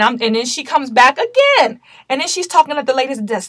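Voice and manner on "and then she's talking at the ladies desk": ordinary talk, nothing marked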